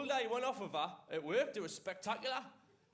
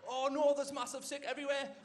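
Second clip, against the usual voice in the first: higher, louder mock-panicked exclamation; mock alarm; 6 semitones higher, 5 dB louder than usual. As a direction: cry out in comic dismay. Acting out panicked reaction to sick.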